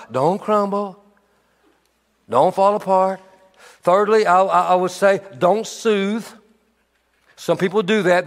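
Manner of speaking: normal talking voice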